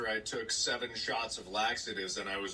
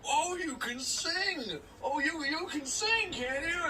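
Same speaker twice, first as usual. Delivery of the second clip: high-pitched, gushing grown-ups; cooing adults; slower than usual. Retold or imitated. Adults at a barbecue egging on a kid, high and gushing. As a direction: imitate adults fussing over a child.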